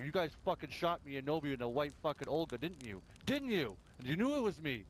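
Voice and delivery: high, heated accusing rant; retold furious accusation. Player reenacting accusing rivals of shooting him, high heated rant. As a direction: tear into them for the ambush. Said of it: "then I'm like".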